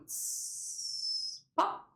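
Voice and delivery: long soft hiss, then a pop; drawn-out "sss" ending in a pop; fading-out sound effect. Retold or imitated. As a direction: imitate an aura fizzling out. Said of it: "it's fading out now. It's going".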